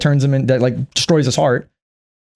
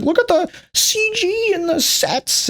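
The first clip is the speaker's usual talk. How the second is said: mock showy gushing; 3 dB quieter, slower than usual. Mocking the movie's showiness.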